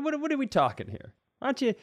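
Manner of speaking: ordinary talk, nothing marked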